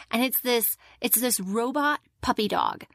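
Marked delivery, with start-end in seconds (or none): none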